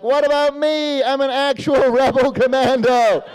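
high-voiced aggrieved neighbor; indignant mock protest. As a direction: demand your share of the attention. Neighbor protests, wanting recognition.

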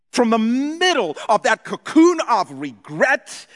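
loud, high preaching call; preaching at full force. Real, not acted. Preacher calling out to the church, loud and high. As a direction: lift the room with it.

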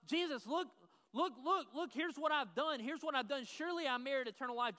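hammed-up self-righteous bragging; mock boastful pleader. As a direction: play a proud man showing off his deeds to Jesus.

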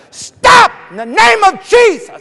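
very loud, high shouted command; full-force commanding yell; sharp rebuke. Retold or imitated. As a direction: shout it to halt a charging dog. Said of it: "I spoke sharply".

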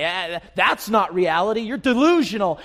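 hammed-up jeer, pitched high; mocking scoffers. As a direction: scoff loudly like a dismissive crowd. Voicing scoffers who will mock.

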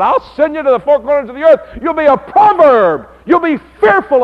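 high, loud prophetic proclamation; the preacher quotes God in a loud, high voice; proclaiming God's word. Acted. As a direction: boom it out like a prophet. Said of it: "where he said".

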